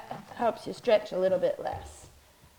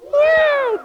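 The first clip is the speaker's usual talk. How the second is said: high, loud falling meow; scared cat meow; 10 dB louder than usual. Pretend. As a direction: squeal it like a frightened kitten. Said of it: "And then it's a scared cat".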